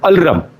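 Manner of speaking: "Alram" with the r moved before the last vowel; mispronounced alarm. Retold or imitated. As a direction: say "Alram", l and r run together. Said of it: "this word is not pronounced as Alram".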